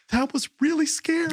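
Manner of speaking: put-on high little-boy voice, shaken; dad voicing his frightened son; small child's voice. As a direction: say it like a rattled little kid. Son speaks after the scare.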